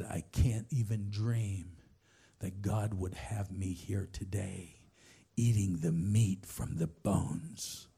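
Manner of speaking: low, slow, weighty retelling; understated, deeply moved testimony; solemn wonder. Retold or imitated. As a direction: let each phrase land slowly in a low pitch. Retold moved confession, climax of story.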